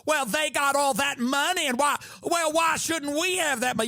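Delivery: camp Southern lilt, teasing. Retold or imitated.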